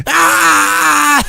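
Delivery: a loud, high shouted yelp; sudden high, loud pug scream. Retold or imitated. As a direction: scream like a tiny old man. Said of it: "they basically scream like a little old man".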